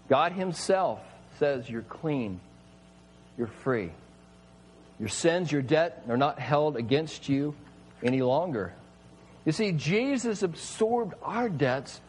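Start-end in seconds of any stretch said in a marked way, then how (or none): 1.7-8.7 tender assurance. Acted. God's words of forgiveness.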